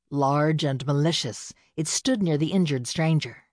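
ordinary delivery

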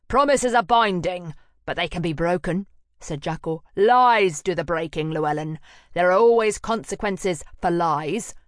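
loud, heavy warning; cold threat. Acted. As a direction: lay down the law menacingly. Jackal threatens.

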